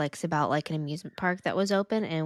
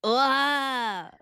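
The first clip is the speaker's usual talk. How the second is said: Jersey devil scream; 7 semitones higher, 4 dB louder than usual. Pretend. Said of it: "how do you do that?".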